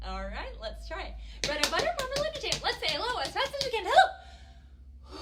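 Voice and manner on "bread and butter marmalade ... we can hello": high, loud, breakneck rhyme chant; even faster. Shown as a demonstration. Storytime leader racing children through the greeting rhyme, super fast. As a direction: race through it at double speed.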